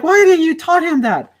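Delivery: high, loud mock complaint; hammed-up annoyed brother; imitating upset brother. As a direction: brother scolding the speaker, mock angry. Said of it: "he was upset".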